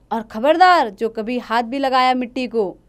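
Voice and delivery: loud warning shout; angry shout. Acted. Father shouts a warning at his daughter, angry. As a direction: bark a warning at her. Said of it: "क्यों चिल्लाकर".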